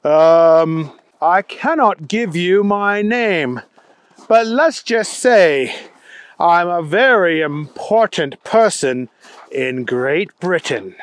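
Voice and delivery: hesitant, self-important British caller; measured put-on British accent, guarded; weird British accent. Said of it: "a pretty weird accent".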